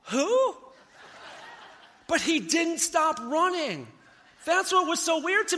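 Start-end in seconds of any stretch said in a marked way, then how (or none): 0.1-0.6 higher, louder puzzled shout; retold yell. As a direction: shout it back, confused. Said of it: "And he yells".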